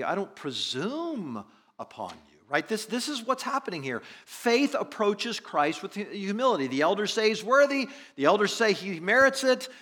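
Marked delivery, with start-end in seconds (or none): 2.7-9.7 high, loud sermon delivery; impassioned preaching. Acted. Preacher urging that he really believed, impassioned. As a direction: preach it at full force, voice raised high.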